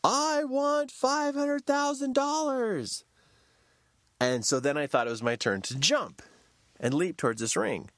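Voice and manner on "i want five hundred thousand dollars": slow, much higher call downward; high drawn-out yell. Retold or imitated. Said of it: "And I yell down".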